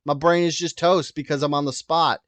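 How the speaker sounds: usual speaking voice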